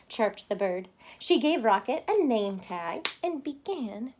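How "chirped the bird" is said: ordinary delivery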